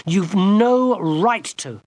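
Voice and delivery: high, slow, loud furious accusation; girl furiously accusing the enchanter. Acted. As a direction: hit no right in fury. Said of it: "Gwendolen said furiously".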